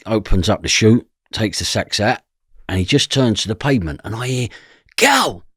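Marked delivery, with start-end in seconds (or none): 5.0-5.4 high shouted call of a name; mock shout across the road. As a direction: yell the name across the street. Stranger calls across street.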